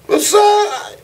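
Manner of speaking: high, bright fake-friendly greeting; mock cheerful. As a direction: play the pushover who just smiles. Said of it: "You say".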